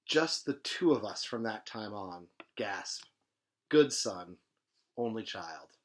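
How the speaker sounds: lively reading of a novel; story reading. A narrator's reading. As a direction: read the novel aloud with energy. Reads novel aloud.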